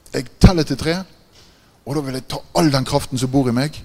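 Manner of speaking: loud, menacing challenge, shouted out; threatening shout. Retold or imitated. Possessed man challenging the preacher, loud threatening shout. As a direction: roar it like a man squaring up for a fight.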